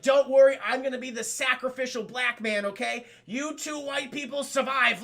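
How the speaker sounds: hammed-up noble farewell, high; heroic sacrifice. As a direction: play the dying hero big.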